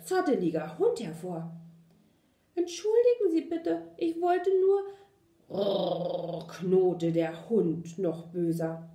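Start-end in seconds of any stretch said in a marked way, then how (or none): 2.5-6.5 high, nervous pleading puppy; timid apology. A narrator's reading. Puppy apologising to the growling big dog, timid and high. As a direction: apologise anxiously in a raised, high voice.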